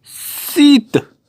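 high, loud and stretched out; drawn-out signing flourish. Real, not acted. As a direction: stretch the name as you sign it. 서명하며 길게 외침. (서명하듯 높고 길게 늘여 말하기)

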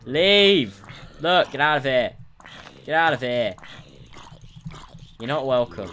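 loud, high order to get out; exasperated full shout; angry yell. Real, not acted. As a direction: shout the intruders away. Yelling at game mobs to go.

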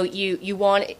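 normal talking voice

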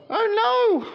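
very high squeaky "oh no"; tiny duckling cry. Acted. Little duckling tumbling into the river, squeaky.

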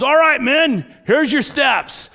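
loud, high drill-call shout; coach shouting. Retold or imitated. Gridiron coach calling dance steps to nervous boys, shouting. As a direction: bellow the steps like a football coach. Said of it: "And he goes".

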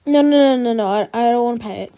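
loud panicky no's; a frightened child refusing to pet the dog; panicked refusal. Acted. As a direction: back away, panicking.

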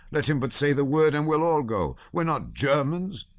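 high, ardent patriotic count; the count backing his daughter, high and fervent; blustering old count. Acted. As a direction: declare it proudly.

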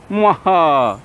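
short mock evil cackle; a hammy villain mwaha; mustache twirling villain. Villain laugh after stealing fish.

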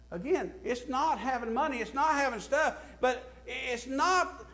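usual speaking voice

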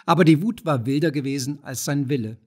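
ordinary delivery

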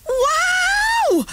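very high delighted shout; yelled. A narrator's reading. Boy riding alone for the first time, yelling in thrill. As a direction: let out a huge high Wow. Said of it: "yelled Tim".

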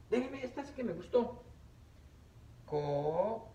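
ordinary delivery

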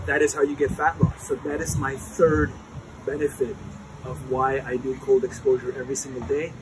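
ordinary talk

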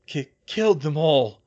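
loud, halting rasp; rasping Bill. A narrator's reading. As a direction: stammer the first word, then rasp it out. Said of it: "Bill rasped".

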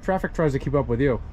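ordinary talk, nothing marked

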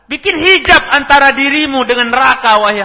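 penjelasan yang diserukan keras dan tinggi; seruan keras. Acted. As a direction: teruskan seruan dengan lantang. Terjemahan seruan Nabi. (terjemahan seruan Nabi dengan suara keras)

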